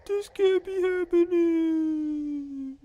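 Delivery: very high, very slow villain wail; villain impression. Streamer voicing defeated Bowser in a high wail. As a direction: wail over the lost empire, stretched out. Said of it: "What was that voice impression?".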